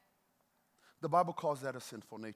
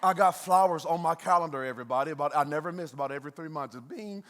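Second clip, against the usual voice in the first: normal talking voice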